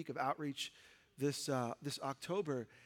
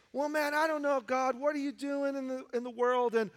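bewildered complaint; 6 semitones higher than usual. Retold or imitated. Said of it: "You may think".

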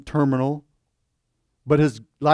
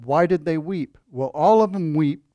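ordinary delivery

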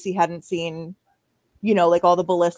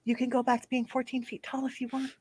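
a little higher and softer, sly aside in the ear; mock whisper; 5 semitones higher, 8 dB quieter than usual. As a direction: lean in and tease him softly. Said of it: "just whisper in Rolex's ear".

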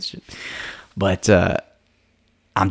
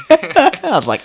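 laughing; 7 dB louder, slower than usual. Real, not acted. Laughing at memory.

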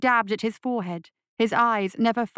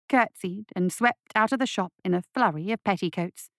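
plain reading voice

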